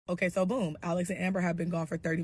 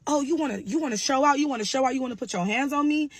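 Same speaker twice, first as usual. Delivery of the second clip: raised, higher, louder challenge; angry confrontation; 6 semitones higher, 4 dB louder than usual. Retold or imitated. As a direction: square up to her. Brother confronting Sarah angrily.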